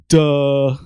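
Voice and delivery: imitating a coworker's smug duh; mocking duh. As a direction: toss off a loud, dismissive duh like a schoolkid. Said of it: "one of the guys on the team is like".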